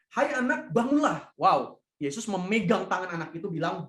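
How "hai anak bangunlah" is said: raised, higher call to wake her; firm command. Acted. Jesus calls out to the dead girl to rise, a raised firm command.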